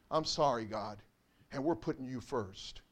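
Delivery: soft, low, contrite words to God; quiet humble repentance; hushed prayer. Retold or imitated. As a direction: murmur the apology to God.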